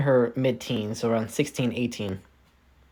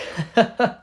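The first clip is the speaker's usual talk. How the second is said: short, higher-pitched put-on chuckle; acted chuckle; 9 semitones higher than usual. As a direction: chuckle briefly in character. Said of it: "Maximilian chuckles. It's like".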